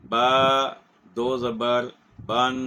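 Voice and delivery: با, دو زبر, بن in separate beats, the alif silent; spelling out tanween. Shown as a demonstration. The teacher shows students that the alif stays silent. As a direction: spell it without sounding the alif. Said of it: "ہم یوں پڑھیں گے".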